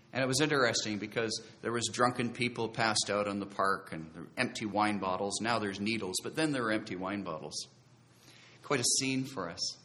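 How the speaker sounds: normal talking voice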